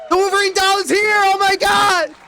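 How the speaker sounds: high-pitched thrilled yelling; excited shout. Real, not acted. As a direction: scream with delight at the arrival. Hyped arrival of the Wolverine doll.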